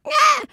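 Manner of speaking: very high short squawk; mock strained shriek. Guest imitating butterflies kept in envelopes, tiny high shriek. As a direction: squeal like a cramped butterfly.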